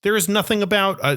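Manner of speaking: ordinary talk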